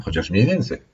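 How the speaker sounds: ordinary delivery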